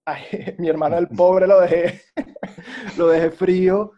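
laughing loudly through the words. Real, not acted. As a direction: laugh it out, embarrassed at the memory. Laughing at own story. (riéndose a carcajadas)

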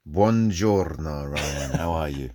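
slow, drawn-out Italian Buongiorno; Italian greeting. Pretend. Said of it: "That was such a nicer accent than".

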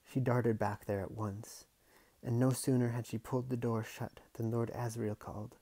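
plain reading voice

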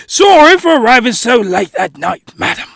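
over-polite fake British guest; hammed-up British politeness; terrible British accent. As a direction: do a bad British gentleman. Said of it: "terrible British accent".

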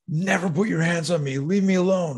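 high-pitched retold warning to a bully; angry warning. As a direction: act out a kid standing up for himself. Retold warning while slamming bully.

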